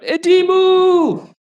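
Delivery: long, loud, high kobold cry; piercingly high creature war cry; shouting kobolds. Acted. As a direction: shout it high and draw out the final vowel. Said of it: "they shout".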